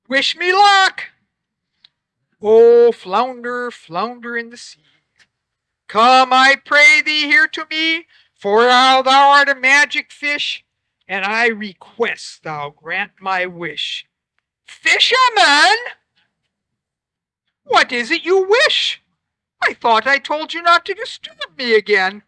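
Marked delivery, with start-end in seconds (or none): 2.4-14.1 loud, formal chant to the sea; summoning rhyme. Acted. 14.7-22.2 a put-upon magic fish; irritated fish scolding the fisherman; grumpy magic fish. Acted.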